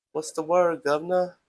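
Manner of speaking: attempted English accent, high and loud. Shown as a demonstration. Streamer showing viewers his accents, attempted English. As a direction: call it out in a put-on English accent.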